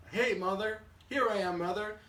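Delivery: put-on high, loud baby-bird greeting; storyteller's excited little-bird call. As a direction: hail mother eagerly from a distance. Bird hails the big machine.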